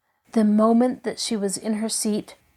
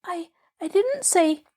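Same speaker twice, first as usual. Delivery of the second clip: timid stammer; 9 semitones higher than usual. A narrator's reading. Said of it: "Heidi began".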